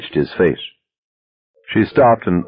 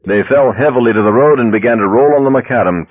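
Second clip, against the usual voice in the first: plain reading voice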